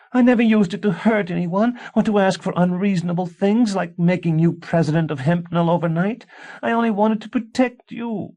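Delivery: put-on high, loud childlike protest; wife defending her magic like a scared child; frightened childish wife. A narrator's reading. A wife pleading with her husband, high and childlike. Tansy voiced by narrator.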